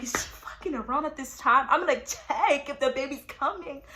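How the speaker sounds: reenacted fed-up patient, emphatic; exasperated outburst. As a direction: snap it loudly in acted frustration. Acts exasperation at the nurse.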